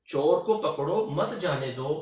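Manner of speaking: "चोर को पकड़ो", slight break, "मत जाने दो"; pause after पकड़ो. Shown as a demonstration. Teacher repeating the comma example, slight pause after "पकड़ो". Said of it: "इस अलामत में हम थोड़ा सा रुके हैं".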